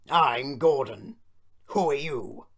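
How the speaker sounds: loud, slow big-engine voice; pompous Gordon. A narrator's reading. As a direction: announce yourself grandly. Gordon introduces himself.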